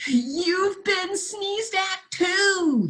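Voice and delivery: put-on high, slow little boy's cry. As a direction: call out like a delighted child. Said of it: "he cried".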